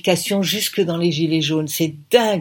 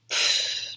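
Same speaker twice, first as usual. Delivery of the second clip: scoffing pfff; 7 dB quieter than usual. Acted. Scoffs at his suggestion.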